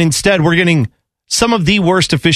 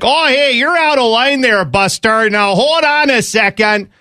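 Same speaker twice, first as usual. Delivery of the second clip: put-on high, nasal-free upper Midwest Wisconsin judge accent, much higher; 9 semitones higher than usual. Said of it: "sounds like someone who was an extra on Bobby's World".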